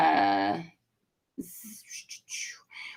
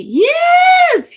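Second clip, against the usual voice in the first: high, loud celebratory yes; voicing a thrilled winner; excited shout; 8 dB louder than usual. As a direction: squeal it out in delight. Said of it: "Antoinette is all sorts of excited".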